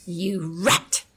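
ordinary delivery, nothing marked

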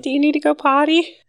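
put-on high, fast, sing-song toddler talk; teacher to toddlers. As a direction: ask it like to a two-year-old. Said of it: "I'm like".

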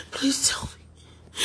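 desperate whispered plea, slow; hushed pleading whisper to God. Real, not acted. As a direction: breathe the plea out without voice.

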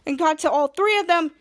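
normal talking voice

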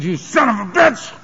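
rising to a high anguished yell, slowing on the last words; a defeated cry aimed upward; retold shout at God. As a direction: hurl it skyward, fed up. Yelling at God in despair.

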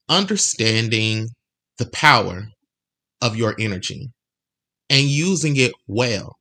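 slow, phrase by phrase, with long pauses; measured emphasis, pausing after each phrase; deliberately slow. Real, not acted. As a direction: space it out so every phrase sinks in. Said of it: "I wanted to say that slow".

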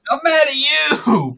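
very high, drawn-out mock yell; out-shout the other in pretend rage; mock-furious shout. Co-host topping the host's accusation, hammed-up shouting.

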